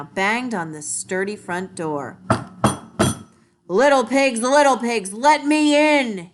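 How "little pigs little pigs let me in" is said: growled wolf voice. A narrator's reading. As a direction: demand entry like a hungry wolf. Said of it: "he growled".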